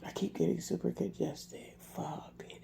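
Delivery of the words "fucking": voiceless whispered swear; whispered curse. Real, not acted. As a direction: breathe the curse out.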